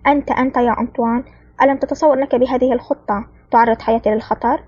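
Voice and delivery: plain reading voice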